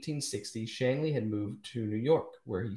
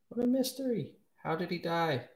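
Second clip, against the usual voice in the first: mock wonder; 8 semitones higher than usual. Real, not acted. Puzzled by the poet's death.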